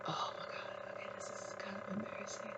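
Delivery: whispered embarrassed aside. Real, not acted. Reader hesitating before an embarrassing message, embarrassed whisper. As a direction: whisper it to yourself, sheepish.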